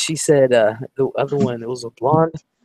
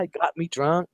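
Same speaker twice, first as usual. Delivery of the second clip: normal talking voice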